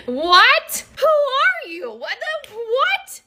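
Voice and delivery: very high, squeaking disbelief; can't believe what she heard; shocked disbelief. Real, not acted. Wife stunned by husband's Rome answer, squeaking in disbelief.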